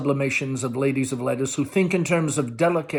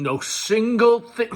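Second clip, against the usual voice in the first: angry son; 9 semitones higher, 4 dB louder, slower than usual. A narrator's reading. Tom and Amanda quarrel.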